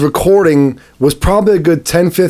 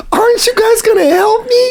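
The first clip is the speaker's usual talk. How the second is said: soft pleading voice. Retold or imitated. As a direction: plead for help in a tiny high voice, like a hurt Karen. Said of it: "like, a very soft voice".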